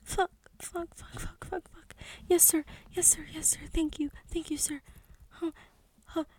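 high, panting gasps of words; breathless afterglow. Acted. Submissive lover thanking her partner, breathless. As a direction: gasp them out, still out of breath.

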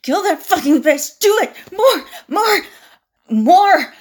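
loud, high commands; egging on with mounting force; frenzied urging. Acted. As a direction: shout it, building to the last word. Urging on an attack.

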